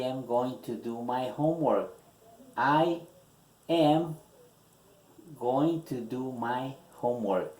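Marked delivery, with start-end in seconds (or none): 2.6-7.5 slow dictation, "I" and "am" set apart by long pauses; dictée mot à mot. Shown as a demonstration. Repeats answer word by word, dictating.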